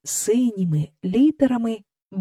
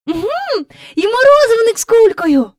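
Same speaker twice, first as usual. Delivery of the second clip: put-on high, loud girlish cry; excited call; 6 dB louder than usual. A narrator's reading. Jane calling out, spotting the ice-cream man. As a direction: point it out with glee. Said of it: "гукнула Джейн".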